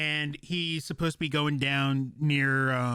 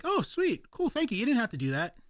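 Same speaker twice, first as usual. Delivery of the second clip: surprised delight; 8 semitones higher than usual. Retold or imitated. Surprised by five stars.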